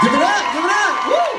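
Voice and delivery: high, rising crowd hype; hype call to the crowd. Real, not acted. Host firing up the audience, excited hype call. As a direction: pump up the audience to cheer.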